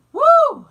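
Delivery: high, loud "woo"; loud whoop. Real, not acted. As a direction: let out a sudden high whoop. Shaking off coughing fit.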